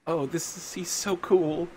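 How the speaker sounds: high, acted teary admiration; tearing up. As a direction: gush as if welling up. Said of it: "like, tearing up".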